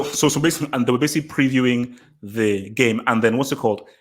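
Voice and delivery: normal talking voice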